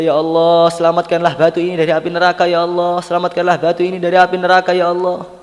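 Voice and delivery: high, loud, fast pleading prayer; fervent supplication. Retold or imitated. Prophet prays for the stone's rescue, fervent pleading. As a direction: implore urgently in one rushing chant-like stream.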